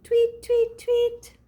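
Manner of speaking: very high chirps; high twittering bird call; bird tweet. Acted. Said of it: "the bird's saying".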